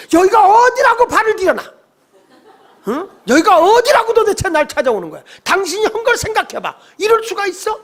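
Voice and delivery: high-pitched outraged tirade, acted out; indignant scolding. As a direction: berate an unwelcome visitor at the top of your range. Acted scolding of returning enemy.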